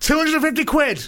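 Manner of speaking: high-pitched market-trader pitch; punchy, high price call; sales shout. Acted. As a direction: hawk it high over the chatter. Hawking laptop over the chatter.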